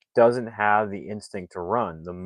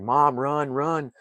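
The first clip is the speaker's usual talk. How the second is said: higher, louder warning call to mom; urgent shout; 8 semitones higher, 4 dB louder, much slower than usual. Retold or imitated. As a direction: yell for her to run. Spike shouting to his mom.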